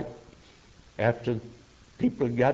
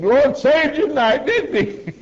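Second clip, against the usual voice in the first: much higher and louder, laughing with delight; joyful wife; 14 semitones higher, 8 dB louder than usual. Retold or imitated. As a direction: burst out laughing with happy knowing glee. Wife joyfully greets saved husband.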